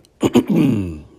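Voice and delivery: loud rough clearing of the throat. Real, not acted. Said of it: "forgive me if I have to clear my throat".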